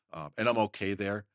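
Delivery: normal talking voice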